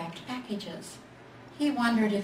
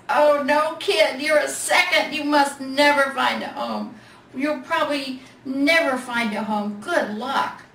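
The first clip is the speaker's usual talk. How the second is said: much higher, louder, dramatic bear; dramatic big bear; 5 semitones higher, 7 dB louder than usual. A narrator's reading. Big Bear tells the little bear he's a second, loud and dramatic. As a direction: announce his doom theatrically.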